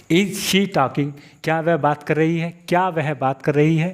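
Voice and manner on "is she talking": very slow, every word stretched; teacher's drawn-out example; slow demo. Shown as a demonstration. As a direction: drill it slowly for learners. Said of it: "ये देखिए".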